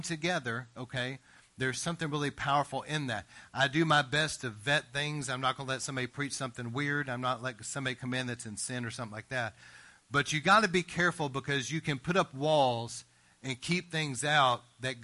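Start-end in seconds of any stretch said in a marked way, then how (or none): none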